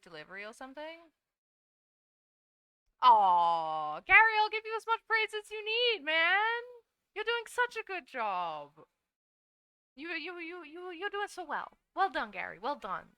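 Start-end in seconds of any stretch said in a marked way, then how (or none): 3.0-11.8 gushing praise. Real, not acted. Lavishing praise on viewer.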